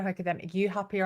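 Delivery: normal talking voice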